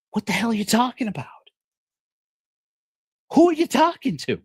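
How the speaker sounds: high, bewildered yelp; he can't believe what he's hearing; baffled Truman. Acted. Truman demanding to know who his wife talks to, high baffled cry. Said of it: "Truman looks at her and says".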